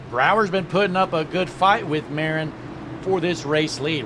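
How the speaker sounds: ordinary delivery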